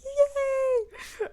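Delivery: very high, drawn-out squeal of joy; happy cheer. Retold or imitated. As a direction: cheer loudly in a tiny high voice. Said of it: "it made me so happy".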